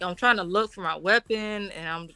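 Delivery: normal talking voice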